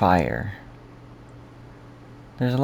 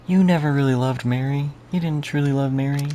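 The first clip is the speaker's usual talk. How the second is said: high, pained accusation; accusing quote; 8 semitones higher than usual. Retold or imitated. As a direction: throw the accusation back at him. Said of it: "as Maria said".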